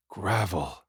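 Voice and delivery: rasped out through a sore throat; hoarse hacking gasp; hacking cough. Retold or imitated. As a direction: cough the word out, throat scraped raw. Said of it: "I hacked".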